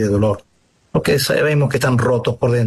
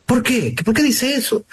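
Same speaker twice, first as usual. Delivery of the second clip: high-pitched, puzzled double question; surprised questioning; 10 semitones higher than usual. Acted. Priest asking the returning man what happened, surprised questioning. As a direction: ask it eagerly, pitched well up.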